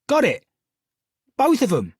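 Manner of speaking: high, loud cry of triumph; Cockney girl calling out her find across the room; excited cry. A narrator's reading. Polly cries out in triumph across the shop, excited. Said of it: "cried Polly from across the room".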